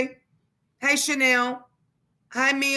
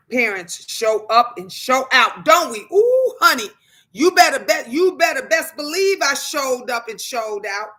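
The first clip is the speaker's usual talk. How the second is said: big sassy boast; excited boast; 2 semitones higher, 6 dB louder than usual. Real, not acted. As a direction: crow it proudly to the viewers. Hyped boast about showing up.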